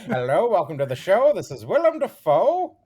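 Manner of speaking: a lightly voiced Willem Dafoe. Comic hosting an imagined impressions show, as Dafoe. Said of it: "I'll be doing Willem Dafoe".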